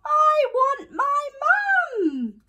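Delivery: put-on very high, slow, loud child's wail; shouted wail. A narrator's reading. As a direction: wail it out, long and desperate. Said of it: "shouted Eddie".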